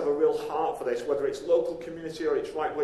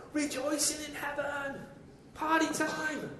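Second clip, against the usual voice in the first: much higher and airy, a playful party cheer; giddy, high-pitched celebration; joyful celebration; 9 semitones higher, 7 dB quieter than usual. Acted. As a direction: lift it up like heaven throwing a party. Celebrating the found one.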